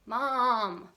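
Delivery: loud, drawn-out call for Mom; child's yell. A narrator's reading. Daughter yelling for mom from her room, calling out. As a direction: yell out for your mother. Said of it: "she yells".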